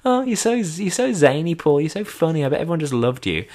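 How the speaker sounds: high-pitched imitation of a teasing partner; mocking praise. Partner hears his award story, mocking. Partner teases him, acted out.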